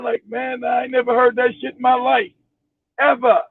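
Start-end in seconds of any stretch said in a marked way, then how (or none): none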